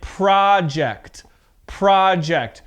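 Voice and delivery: careful noun project, open vowel and strong first syllable; stress on first syllable. Shown as a demonstration. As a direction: project as PRAH-ject, stressed beginning. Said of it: "the stress is at the beginning".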